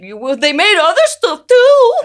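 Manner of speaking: high, loud, slow mocking voice; hammed-up put-down drawn out high; mock dismissive. Mocking her co-host's lame defence of the writer. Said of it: "You're just like".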